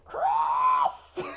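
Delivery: high, breathy mock yell from afar; mom yelling name. Mom calling her son in from outside at dusk, mock yell. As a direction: yell Chris like mom at the door. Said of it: "as my mom would say when I was playing outside".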